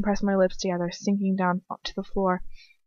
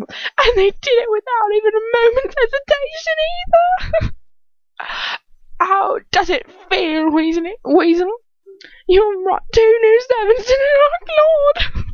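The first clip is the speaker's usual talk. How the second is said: gloating villain; 5 dB louder than usual. A narrator's reading. Bellatrix taunting Ron about his parents, shrieking gloat. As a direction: screech the taunt with hammed-up glee.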